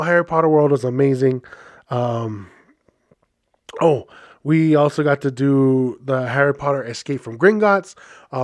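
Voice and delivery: ordinary delivery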